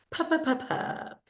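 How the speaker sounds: "Pepper, pepper" as puffed puh-puh-puh-puh, soft; repeated p sound. Shown as a demonstration. Teacher hinting that pa sound is repeated, puffed p's. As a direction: softly puff out the p's of "Pepper, pepper". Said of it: "pa sound is repeated".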